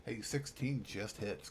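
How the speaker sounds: ordinary delivery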